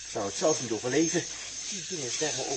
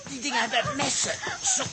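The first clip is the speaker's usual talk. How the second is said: urgent high-pitched coaxing; 5 dB louder than usual. Acted. Dirk helping Els amid rats.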